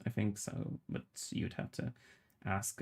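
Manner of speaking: drops to a soft whisper; half-whispered under the breath; whispered aside. Real, not acted. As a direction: murmur it as a quiet aside.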